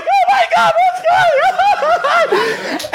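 very high-pitched panicked squeal; hammed-up shriek of a terrified kid; mock panic. Teen being shaken by his section leader, squealing in mock panic.

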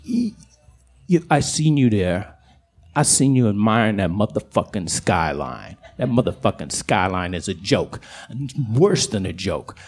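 low, scornful coyote voice; deep, grave put-down of human works; South Side coyote. Acted. Coyote lectures the skyline admirer, low and scornful. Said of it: "south side coyote".

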